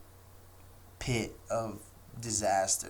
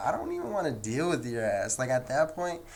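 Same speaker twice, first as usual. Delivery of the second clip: ordinary talk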